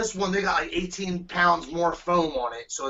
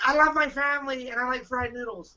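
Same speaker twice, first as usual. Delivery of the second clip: mock contestant; 9 semitones higher than usual. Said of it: "he's like".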